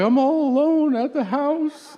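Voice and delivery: slow, high-pitched whiny wail; mock crying. Wife on the phone to her touring husband, mock tearful wail. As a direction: imitate a tearful wife, stretching it out. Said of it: "she cries when I leave".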